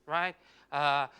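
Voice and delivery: ordinary delivery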